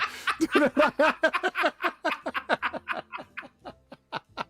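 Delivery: high, sustained laughter; long laugh. Real, not acted. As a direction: laugh helplessly.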